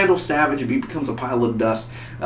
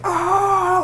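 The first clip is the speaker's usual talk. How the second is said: high-pitched mock running yell; drawn-out falsetto yell at ordinary volume; Flash. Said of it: "when Flash is running and he yells".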